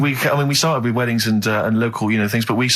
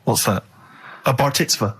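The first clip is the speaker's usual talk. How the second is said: normal talking voice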